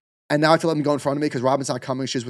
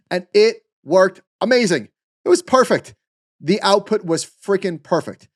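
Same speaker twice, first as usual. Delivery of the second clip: excited; 6 semitones higher, 4 dB louder, slower than usual. Real, not acted. Raving about the AI answer.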